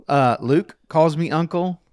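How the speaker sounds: ordinary delivery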